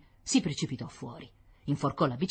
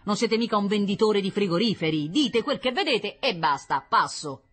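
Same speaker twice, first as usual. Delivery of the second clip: thundering; 7 semitones higher, 5 dB louder than usual. A narrator's reading. General scolding the scout over the radio, thundering. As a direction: lay down the order forcefully. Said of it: "tuonò Diomede".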